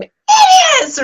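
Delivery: very high, loud angry shout; screaming at them. Retold or imitated. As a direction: re-enact yelling furiously after kids down the road. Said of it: "I remember screaming at them".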